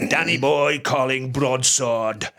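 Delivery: put-on slow Welsh-accented radio call. Quoting Where Eagles Dare, slow Welsh accent. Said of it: "You know, that Welsh accent".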